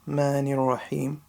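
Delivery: ordinary talk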